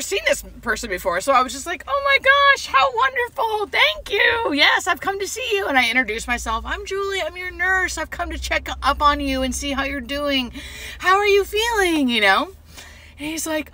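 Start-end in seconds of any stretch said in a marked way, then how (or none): 1.9-5.7 very high, gushing thanks; delighted greeting. Retold or imitated. Playing along with patient's delight. 6.7-12.1 high, sweet bedside voice; cheery nurse greeting. Retold or imitated. Nurse greeting her patient.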